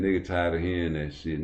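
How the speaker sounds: usual speaking voice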